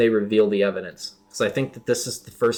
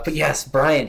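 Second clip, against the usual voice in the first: usual speaking voice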